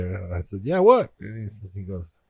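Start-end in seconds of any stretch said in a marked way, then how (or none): 0.6-1.1 higher-pitched "yeah, what?"; curious reply. Retold or imitated. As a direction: retell a quick questioning comeback, pitched up. Retelling his own reply to friend.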